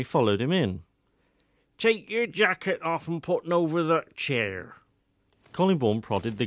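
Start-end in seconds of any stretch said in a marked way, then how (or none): 1.8-4.8 put-on a proud old villager boasting of his garden; old Wiltshire countryman. A narrator's reading.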